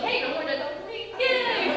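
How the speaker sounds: high-pitched mock celebration; gleeful cheer. Acting out her happy relief.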